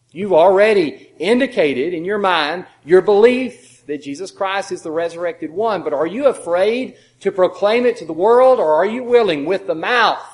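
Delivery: loud, slow fervent preaching. Acted. Preacher challenges the listeners, loud and fervent. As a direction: press the question on the congregation.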